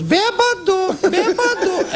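very high squeaky taunting call of bêbado; shrill-pitched mocking snake calling out. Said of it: "the voice goes".

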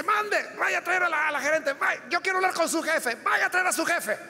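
high, demanding mock rant; shouting pastor. Angry pastor demanding the manager from the frightened cashier, high mock shout. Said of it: "empezó a gritar".

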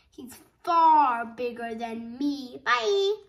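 loud, emphatic little goat; hammed-up loud goat plea; littlest goat escaping. A narrator's reading.

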